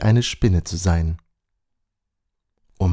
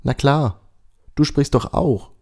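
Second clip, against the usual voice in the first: higher, cheerful spider voice; friendly spider; 6 semitones higher than usual. A narrator's reading. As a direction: answer as if it were obvious. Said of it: "antwortet die Spinne freundlich".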